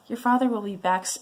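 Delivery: ordinary delivery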